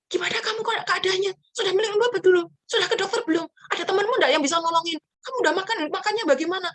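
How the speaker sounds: high, loud, rapid barrage of anxious questions; fussing worried mother. Retold or imitated. Worried mother firing questions at her sick child, high and loud. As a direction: pepper the sick child with questions like a frantic mom. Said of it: "berondong pertanyaan".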